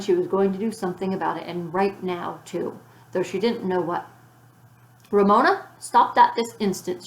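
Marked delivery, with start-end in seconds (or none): 5.1-7.0 louder stern command; ordering. A narrator's reading. Beezus ordering Ramona to stop her tantrum, stern. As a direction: order her like a fed-up big sister. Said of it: "she ordered".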